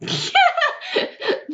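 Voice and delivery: high burst of laughter. Real, not acted. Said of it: "My reaction to anything".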